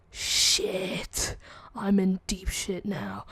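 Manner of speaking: slow, drawn-out whisper; hushed, acted panic under the breath; whispered dread. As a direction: whisper it like you know you're doomed. Retells own dread at being summoned.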